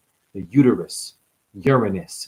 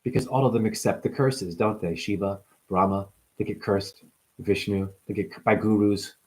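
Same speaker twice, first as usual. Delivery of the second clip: ordinary delivery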